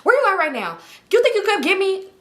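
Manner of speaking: high-pitched, impatient questioning; acted-out exasperated friend on the phone; irritated phone call. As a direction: imitate a friend demanding a pickup. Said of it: "She looked irritated as hell".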